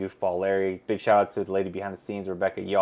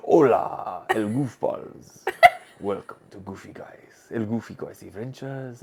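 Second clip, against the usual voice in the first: 1 slow mock Spanish accent; slower than usual. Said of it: "my accent sounds better".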